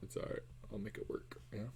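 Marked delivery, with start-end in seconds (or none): none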